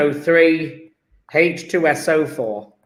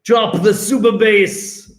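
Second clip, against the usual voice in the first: drawn-out mock-Jamaican delivery; hammed-up Jamaican DJ call; Jamaican accent; 3 semitones higher, 3 dB louder than usual. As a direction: drop it slowly in a playful Jamaican accent. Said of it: "I don't know why I've become Jamaican".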